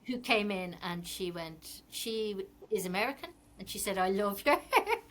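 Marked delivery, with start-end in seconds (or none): 4.0-5.0 laughing mid-quote; quoted compliment with a laugh inside; amused quote. Retold or imitated. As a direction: imitate an admiring customer, breaking into a laugh. Amused retelling of a customer's request.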